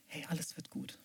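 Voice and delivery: put-on gently calm a frightened child, with pauses; soothing parent. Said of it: "deswegen flüstert er". (leise, beruhigend wie Eltern zum Kind)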